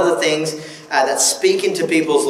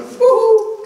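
high, laughing woo-hoo cheer; a jokey little cheer of triumph; 3 dB louder than usual. Real, not acted. Preacher cheers his country's happiest-country title, laughing.

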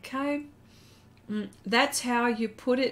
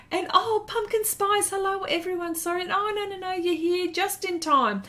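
excited greeting; 9 semitones higher than usual. Real, not acted. Greeting a newcomer to the stream.

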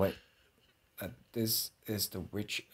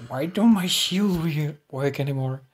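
higher, louder, slowed yell; frustrated yell; 6 semitones higher, 8 dB louder than usual. Real, not acted. Gamer yelling at his broken shield, frustrated. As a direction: cry out in disbelief.

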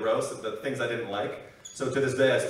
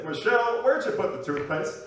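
lightly put-on, higher-pitched call; practised impression voice; 7 semitones higher than usual. Comedian rehearsing alone in the bathroom, lightly put-on higher voice. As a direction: call out higher, in a slight character voice. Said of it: "I'll say".

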